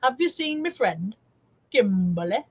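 lightly voiced Victoria Wood impression; a hint of Victoria Wood asking sweetly; mock Victoria Wood line about a friend. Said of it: "saying it Victoria Wood voice".

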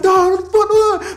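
high, loud exasperated yell; re-enacted indignant outburst; getting mad. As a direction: snap back at being doubted. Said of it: "So I'm getting mad at them".

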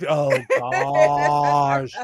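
ordinary talk

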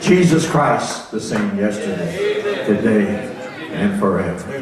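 preached declaration. Real, not acted. Preacher declares scripture line.

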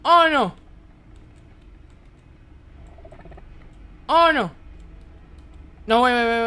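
high, loud wail of dread; alarmed cry. Real, not acted. Player scared by what the horror game shows, alarmed cry.

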